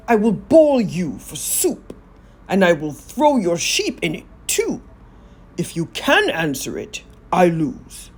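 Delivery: a smug giant daring them to try; gloating giant. Acted. Giant sets the riddle terms.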